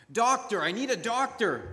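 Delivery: desperate gasp for a doctor; gasped plea. Acted. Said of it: "the woman gasped".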